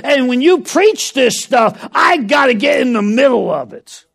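fervent revival preacher building to a shout; full-force preaching. Acted. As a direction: preach it to the rafters, rising to shouts.

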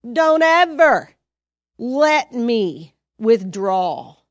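fervent prayer spoken aloud. Shown as a demonstration. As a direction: pray it out with force. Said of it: "So you say".

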